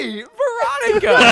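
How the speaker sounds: high, slow, loud call; shouted greeting. Pretend. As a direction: call out her name, slow. Mock greeting shouted at answer.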